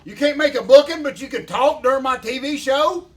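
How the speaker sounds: high, loud mock yell across the room; playful fake outburst at the noisy guys; mock shout. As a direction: bark it like someone cutting in on your show. Yells at the room mid-show.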